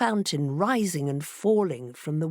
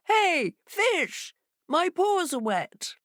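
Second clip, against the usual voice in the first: much higher, peevish fox; fox complaining; 12 semitones higher, slower than usual. A narrator's reading. Fox on the fish's back complaining, mildly peevish. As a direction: whine the complaint. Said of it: "began to complain".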